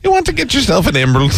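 high, fast, put-on Scottish nanny voice; Mrs. Doubtfire impression. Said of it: "it's Missus Doubtfire".

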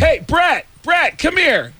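high-pitched holler across a room; calling out. Retold or imitated. Quarterback summoning Brett across media center, calling out. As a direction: call your buddy over, voice pitched high.

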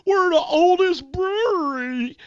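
high, slow, loud put-on boast that ends in a laugh; mocking Pennsylvania's Yuengling pride in a high voice; mock Pennsylvanian pride. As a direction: parody a smug brewery boast. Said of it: "You know, like".

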